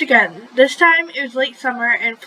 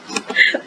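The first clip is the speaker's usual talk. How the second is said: breathy, voiceless laugh; helpless short laugh breaking character; real laughing fit. Real, not acted. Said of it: "I'm laughing so much".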